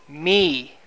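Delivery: "Me." alone, firm and weighty; stress on me. Shown as a demonstration. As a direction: land hard on "Me.". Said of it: "Me is the emphasis".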